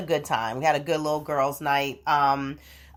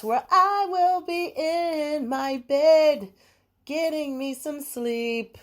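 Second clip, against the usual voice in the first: dreamy longing; 9 semitones higher, slower than usual. Real, not acted. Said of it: "I think I wanna go lay down".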